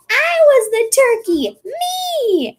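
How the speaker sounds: very high, shrill excited robot squeal; squeaky Gurr robot. Retold or imitated. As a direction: squeal it like a manic little robot. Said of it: "He's like".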